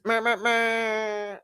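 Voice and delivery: high, drawn-out "meh" held long; mocking meh noises. Imagined drive-by past the landlord's house, mocking noises. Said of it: "driving by her house like this".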